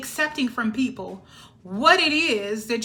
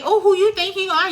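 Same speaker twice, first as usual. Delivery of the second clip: mocking hater; 7 semitones higher, 7 dB louder than usual. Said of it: "Everybody likes to say".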